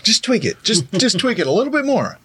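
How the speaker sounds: high mock actor voice; hammed-up actor, fiddling. Voicing actor fiddling with accent.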